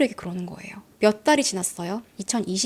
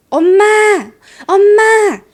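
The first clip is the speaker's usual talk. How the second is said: much louder, higher cry for mom; children shouting for their mother; calling for mom; 9 semitones higher, 11 dB louder, slower than usual. Retold or imitated. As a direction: call out for mom like a scared kid. Kids calling mom for help.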